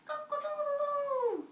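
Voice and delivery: playful high cock-a-doodle-doo; rooster crow. Shown as a demonstration. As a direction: crow like a cockerel for a child. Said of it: "What noise does the cockerel make".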